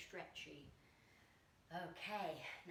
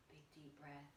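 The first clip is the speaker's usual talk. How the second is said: much softer, lower voice; soft breath cue; 6 semitones lower, 12 dB quieter than usual. Shown as a demonstration. Teacher settling children after the salutation, hushed and low. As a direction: let it sink down like an exhale.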